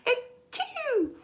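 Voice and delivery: tiny high-pitched squeak of a sneeze; cute sneeze. Shown as a demonstration. Showing her two sneezes, the cute little one. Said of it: "Oh, it's so cute".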